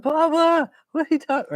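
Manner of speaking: put-on high, childlike voice for the young son; little son's voice. Little boy protesting to his papa, high childlike voice. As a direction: play the small boy protesting to his dad.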